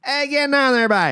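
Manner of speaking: high, lilting Newfoundland "how you getting on there, bye"; a Newfoundland greeting to a buddy; Newfie accent. Retold or imitated. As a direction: sing it out like a Newfie. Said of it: "that's more Newfie".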